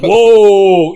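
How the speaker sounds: loud, drawn-out exclamation; hammed-up loud alarm; mock startled reaction. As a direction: play a film crew taken aback. Acts out filmmakers' startled reply.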